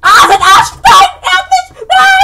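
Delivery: high shrieking no; panicked screaming. Real, not acted. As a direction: shriek as it grabs you. Caught by titan.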